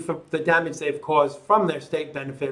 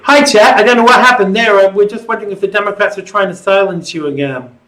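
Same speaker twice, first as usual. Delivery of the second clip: radio host; 4 semitones higher, 9 dB louder than usual. Acted. Host greets the caller again.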